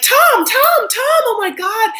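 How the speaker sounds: shrieking high, loud starstruck fans; high squealing mob of fashion fans yelling for Tom; fangirl screaming. Retold or imitated. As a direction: scream his name like a crazed fan. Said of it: "Everyone's like".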